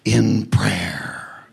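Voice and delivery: hushed, slow whisper; urgent whisper. Real, not acted. Preacher whispering to the congregation to pray. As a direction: breathe the words out slowly.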